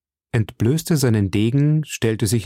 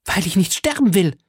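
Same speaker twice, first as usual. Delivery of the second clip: high, strained outburst; desperate protest; 9 semitones higher than usual. A narrator's reading. Milady struggles against the executioner, protesting high and desperately. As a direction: cry it out as if fighting someone off.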